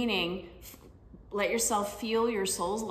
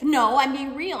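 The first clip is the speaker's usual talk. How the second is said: loud, a bit higher, firm retort; defensive insistence; 5 semitones higher, 8 dB louder than usual. Retold or imitated. Mom answering her daughter, loud insistent retort. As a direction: insist you're only being realistic.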